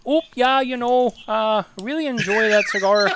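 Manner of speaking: mock the nice Canadian guy, chirpy and loud; polite Canadian Mitchell. Teasing the polite Canadian friend, high mock-polite voice. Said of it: "he's like".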